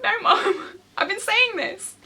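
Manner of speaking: high, laughing exasperation. Retold or imitated. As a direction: laugh it out, told-you-so. Vindicated, laughing at mum.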